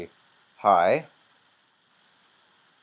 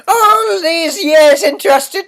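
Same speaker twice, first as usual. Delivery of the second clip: put-on much higher, louder, slow wailing old sister; funny high voice; 19 dB louder, slower than usual. Gamer gives the sister a funny voice, high slow wail. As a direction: wail it in a silly falsetto. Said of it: "Her voice is very weird".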